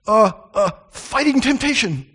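put-on high, loud little-boy excuse; caught little boy. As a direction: imitate a child caught in the act, stammering. Boy caught red-handed makes excuse.

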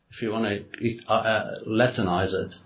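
normal talking voice

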